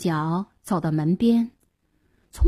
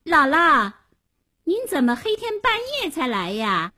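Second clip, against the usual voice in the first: put-on a girl's high, raised question; high questioning child; 7 semitones higher than usual. A narrator's reading. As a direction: call through the door, high. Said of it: "高声问道".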